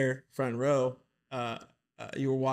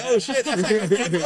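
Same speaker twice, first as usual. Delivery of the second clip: excited; 7 semitones higher, 6 dB louder than usual. Retold or imitated. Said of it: "I was like".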